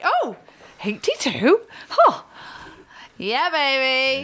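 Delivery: lightly voiced surprised clerk, slow; a hint of an impressed older lady; imitating a clerk. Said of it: "But she was like".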